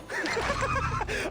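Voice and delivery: high, manic cackle; mad laugh. Acted. Townsperson asked about nightmares, high mad laughter. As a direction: cackle wildly at the question.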